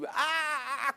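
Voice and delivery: mock yell from afar, high; high mock scream; distant yelling. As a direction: yell "ah, ah" high and long, someone in trouble. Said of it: "j'entends gueuler".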